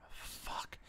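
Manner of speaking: whispered curse. Real, not acted.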